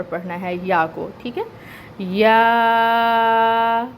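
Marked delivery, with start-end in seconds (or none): 2.0-3.8 یا held for four counts; یا drawn out in slow recitation; four-count madd. Shown as a demonstration. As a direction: stretch یا long, four beats. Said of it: "four counts کے برابر".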